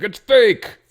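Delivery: high, loud imitation of disappointed public; mock outcry. Host voicing the public's reaction to disappointment, mock outcry. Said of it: "we're gonna be like".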